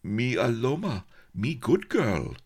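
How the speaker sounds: high, hopeful plea in broken English; hopeful little self-introduction. A narrator's reading. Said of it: "said hopefully".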